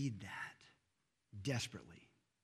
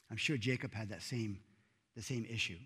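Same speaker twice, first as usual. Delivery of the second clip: ordinary talk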